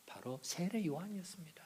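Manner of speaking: hushed reveal whisper. Real, not acted. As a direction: whisper the answer for effect. (속삭이듯이)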